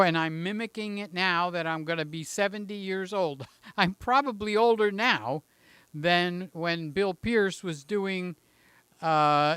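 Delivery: ordinary talk, nothing marked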